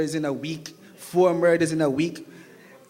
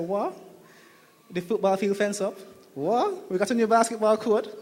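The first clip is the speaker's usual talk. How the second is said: high incredulous questions; surprised; 4 semitones higher than usual. Retold or imitated. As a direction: sound amazed at the changes. Retelling surprise on return.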